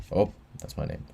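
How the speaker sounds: usual speaking voice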